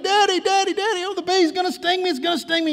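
very high, imitated little-girl wail; panicked crying out. Little girl in back seat begging daddy, panicked. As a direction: plead in a frightened child's high voice. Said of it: "begins to cry again".

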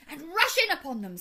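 rally the troops to charge; rousing, emphatic call; rallying cry. A narrator's reading. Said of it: "said the badger".

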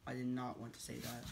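muttered fast, under breath; quiet quick grumble. Pretend. Grumbling after forced word.

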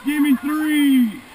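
loud, slow paddle call; shouted command. Real, not acted. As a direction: hold each word over the roar. Guide calling strokes in rapid.

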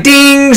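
very high mock buzzer ding; ping it like an eager quiz buzzer. Nerd buzzing in at trivia.

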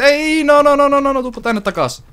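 high loud repeated "no, no"; frantic protest. Real, not acted. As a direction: scramble to pull it back. Item slipping away in game. (kiihtynyt vastalause)

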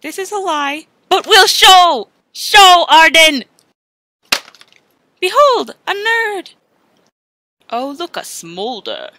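usual speaking voice

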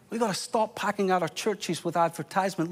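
ordinary talk

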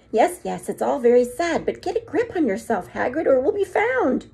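usual speaking voice

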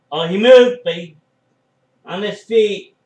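loud, high surprised yell; excited shout. Real, not acted. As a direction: burst out as he escapes. Sudden escape in match.

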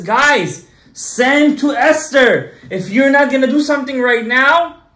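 urgent rallying call. Acted. Mordechai rallying the people.

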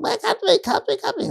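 very high, mock desperate cry; imitated screaming crowd. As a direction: wail it like a begging crowd. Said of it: "I was letting it go on".

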